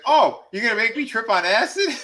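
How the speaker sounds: high, loud, laughing disbelief; mock incredulous. Recapper voices a man offered a drug drink, mock incredulous. Acting out character's startled reaction.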